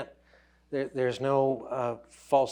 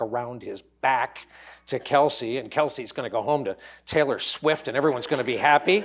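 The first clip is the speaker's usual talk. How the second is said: ordinary delivery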